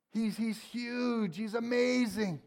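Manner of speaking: hushed awe at God's greatness; a touch of reverent wonder; awed. Real, not acted. Awe-struck emphasis in sermon.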